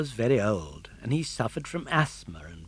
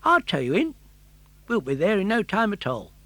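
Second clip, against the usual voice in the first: high, quick friendly fish voice; a chatty fish, fast and bright; tunny fish; 8 semitones higher, 5 dB louder than usual. Acted. The tunny fish greeting Pinocchio, quick and cheerful.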